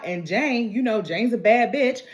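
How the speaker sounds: ordinary talk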